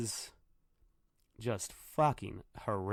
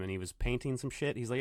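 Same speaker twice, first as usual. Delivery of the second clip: usual speaking voice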